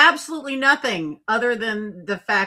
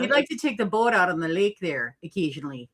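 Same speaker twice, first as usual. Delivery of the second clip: playful, hammed-up Minnesota accent; put-on Minnesotan lilt; 3 semitones lower than usual. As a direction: lay on a folksy Minnesota accent. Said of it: "I was hoping I'd get that accent".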